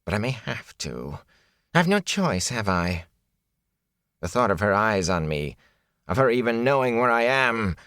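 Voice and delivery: high, uneasy character voice, dropping to reluctance; anxious, wavering peddler; fearful peddler. Acted. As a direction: fret aloud about someone you dread. Peddler frets about the Aes Sedai.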